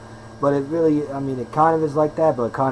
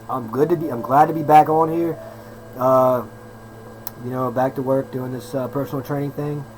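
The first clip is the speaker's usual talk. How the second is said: ordinary talk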